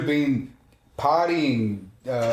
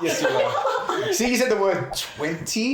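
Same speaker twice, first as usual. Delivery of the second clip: drawn-out laughing tease; slow teasing laugh; 7 semitones higher, slower than usual. Real, not acted. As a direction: tease him slowly through laughter. Teasing about age.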